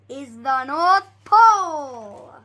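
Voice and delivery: very loud there; guard's big announcement. Acted. Guard showing the children the North Pole, loud. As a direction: announce the sight.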